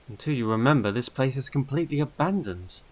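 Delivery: ordinary delivery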